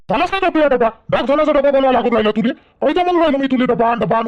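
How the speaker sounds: high, agitated scolding; impatient scolding. Acted. Husband scolding his wife over the late lunchbox, angry and impatient.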